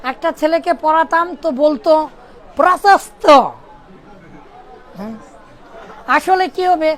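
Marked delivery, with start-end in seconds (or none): 2.6-3.2 'প্রশস্ত' with s instead of sh; mispronounced sibilant. Retold or imitated. Pupil unable to say sh, 'প্রশস্ত' with plain s. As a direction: say 'প্রশস্ত' as 'prosasto', hissed s. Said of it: "তালব্য স উচ্চারণ করতে".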